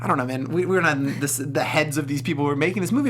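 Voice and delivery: ordinary talk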